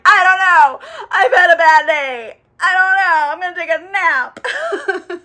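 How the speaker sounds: high, loud, drawn-out mock wail; exasperated yelling. Battered vampire answering worried friends, loudly exasperated. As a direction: whine it at full volume, dragging the words. Lestat's acted exasperated reply.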